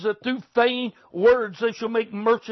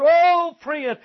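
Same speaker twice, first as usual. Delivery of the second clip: a sudden, higher, louder cry of feeling; loud outcry; 6 semitones higher, 5 dB louder than usual. Real, not acted. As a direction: burst out as if the thought hurts.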